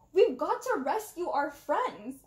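mock shriek of alarm. A narrator's reading. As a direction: shriek at the pilot in panic. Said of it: "I shrieked at Hercule".

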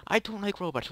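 high, loud mock-shocked protest; sudden outburst. Player abruptly contradicting his love of robots, high outburst.

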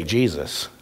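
low, soft, simple reply; quiet answer. Retold or imitated. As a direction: say it calmly, like a child who just knows. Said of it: "They can say".

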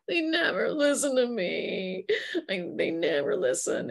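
in tears. Retold or imitated. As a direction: play a victim close to tears. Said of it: "they're in tears".

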